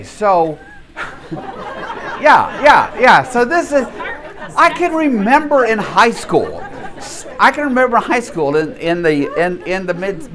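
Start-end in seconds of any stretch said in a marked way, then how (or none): 1.3-8.8 talking through real laughter, pitched up; amused laugh running through the line. Real, not acted. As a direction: chuckle the words out, higher. Laughing while starting a memory.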